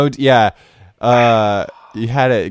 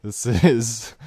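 ordinary talk

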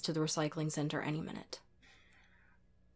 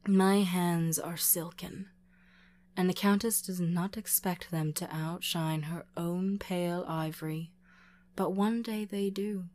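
plain reading voice

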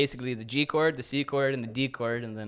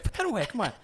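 mock scolding; 11 semitones higher, faster than usual. Mock scolding his dog.

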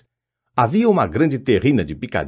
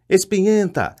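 higher-pitched mocking poltergeist cry; taunting yell; 10 semitones higher than usual. A narrator's reading. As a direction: jeer at her as she flees. (grito zombeteiro e agudo de poltergeist)

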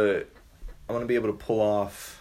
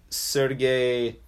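usual speaking voice

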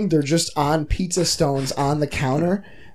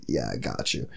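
much lower, softer laid-back dude; deep big-guy voice; 9 dB quieter than usual. Retold or imitated. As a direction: drop way down like a huge bearded guy. Voicing the huge pizza guy.

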